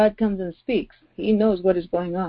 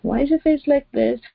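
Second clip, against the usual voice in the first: higher-pitched, gently coaxing God; God's earlier voice, raised in loving concern; loving warning; 5 semitones higher than usual. Acted. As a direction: plead like a caring father giving advice. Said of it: "it was a warning with a different tone".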